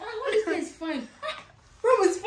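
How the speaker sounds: retold excited shout. Guest mimics a man admiring the room, mock shouting. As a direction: imitate an amazed man exclaiming, half laughing. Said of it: "the guy was shouting".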